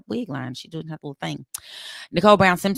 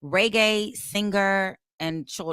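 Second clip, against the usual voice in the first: ordinary talk, nothing marked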